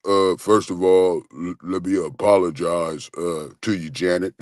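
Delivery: a tall man's deep apology; deep apologetic voice. Retold or imitated. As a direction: drop the voice way down and apologize. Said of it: "then said".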